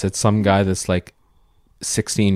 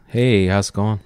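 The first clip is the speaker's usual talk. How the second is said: ordinary delivery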